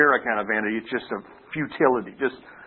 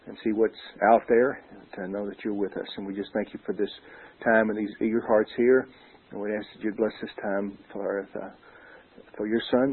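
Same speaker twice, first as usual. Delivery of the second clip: prayer; 5 semitones lower, 4 dB quieter than usual. Acted. Opens with a word of prayer.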